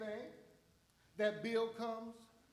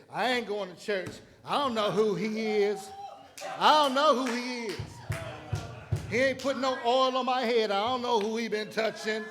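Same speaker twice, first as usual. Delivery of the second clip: griping churchgoer; 3 semitones higher, 7 dB louder than usual. Retold or imitated. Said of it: "grumbling churchgoer voiced".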